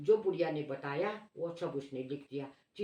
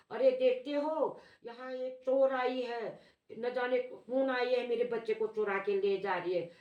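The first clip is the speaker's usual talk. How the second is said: shouting alarm; 6 semitones higher than usual. Retold or imitated. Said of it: "ऐसे वो चिल्लाने लगी".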